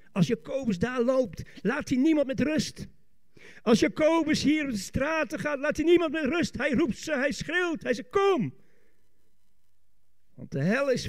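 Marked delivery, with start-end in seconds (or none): 0.1-8.5 high, driving preacher's voice building to a cry; fiery preaching. Real, not acted. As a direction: build excitedly to a shouted summons. Preaches on Jacobus crying out. (vurig prekend met stemverheffing)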